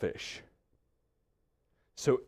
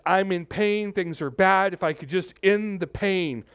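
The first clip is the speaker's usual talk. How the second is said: raised, higher voice of a man in anguish; voicing Job in pain; 8 semitones higher, 6 dB louder, slower than usual. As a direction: cry out Job's pain, pitched up and louder. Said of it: "the language that he's talking is".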